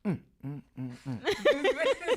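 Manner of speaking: chewing with lips closed; chewing sounds. Real, not acted. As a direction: mouth full, humming mm. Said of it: "I don't have to talk while I'm eating".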